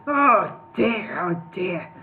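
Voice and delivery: choked, strained voice; strangulated voice. A narrator's reading. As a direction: squeeze it out strangled. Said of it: "in a strangulated voice".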